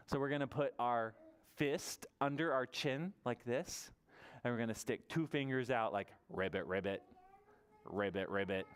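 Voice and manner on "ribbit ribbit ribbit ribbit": low frog "ribbit"; deep, playful frog noises; frog croak. Pretend. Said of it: "This is our big frog mouth".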